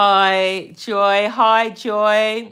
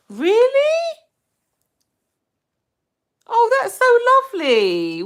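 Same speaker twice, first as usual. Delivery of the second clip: shrieked high, louder disbelief; startled delight at a cheeky comment; real squeal of amused surprise; surprised; 5 dB louder than usual. Real, not acted. Viewer says she fixed his problem.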